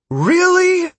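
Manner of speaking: very high, loud disbelief; incredulous shout. A narrator's reading. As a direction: shriek the question in exasperation. Sierra incredulous mid-battle.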